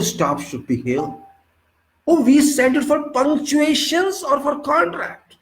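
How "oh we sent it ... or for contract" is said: high-pitched disbelief, slightly louder; incredulous outburst. Real, not acted. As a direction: exclaim as if you can't believe the absurdity. Outrage at punctuation comments.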